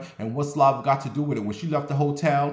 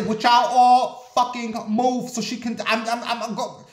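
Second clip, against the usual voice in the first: furious shout, much higher and louder; going off; 10 semitones higher, 5 dB louder than usual. Retold or imitated. Passenger yelling at the packed bus to move back, furious shout. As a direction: blow up at the crowd to make room. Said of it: "I went off today".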